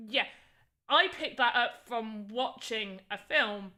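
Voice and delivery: usual speaking voice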